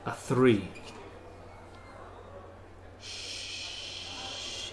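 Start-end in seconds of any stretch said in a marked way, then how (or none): 3.0-4.7 barely audible whispered swear; whispered curse. Acted. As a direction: whisper it to yourself. Losing at a card game.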